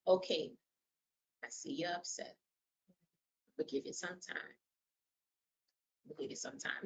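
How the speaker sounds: hushed, unhurried calming tone; slow, low, calm. Shown as a demonstration. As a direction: reassure gently, with long pauses. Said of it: "Just slow, low, calm".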